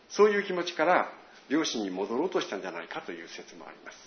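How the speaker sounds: usual speaking voice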